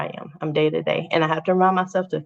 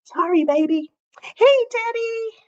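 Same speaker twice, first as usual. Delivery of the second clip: much higher, bright sing-song hello; excited high-pitched welcome; high cheery greeting. Real, not acted. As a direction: call out a sweet hello to a viewer. Greeting someone in live chat.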